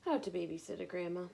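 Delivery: lively picture-book read-aloud; bright, expressive storytime reading; reading to children. Acted. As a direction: read it like storytime for little ones.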